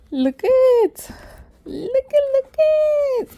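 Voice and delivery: very high, squealing with delight; excited squeal. Real, not acted. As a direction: squeal over the gift. Thrilled at the gift.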